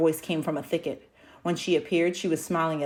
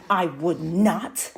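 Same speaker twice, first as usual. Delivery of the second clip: snapped; 5 dB louder, much slower than usual. Acted. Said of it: "Ko snapped".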